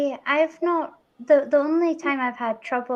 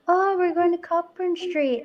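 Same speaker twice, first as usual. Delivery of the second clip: higher-pitched mock tourist voice; imitating visitors; 7 semitones higher than usual. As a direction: mimic a clueless visitor, pitched up, COCK in "Cockburn". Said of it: "So people are like".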